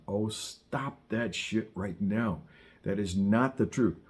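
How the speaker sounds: usual speaking voice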